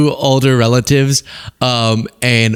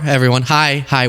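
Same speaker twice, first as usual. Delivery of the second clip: ordinary talk, nothing marked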